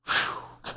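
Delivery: breathy soft whew of relief; relieved whew. Retold or imitated. Preacher mimics relief.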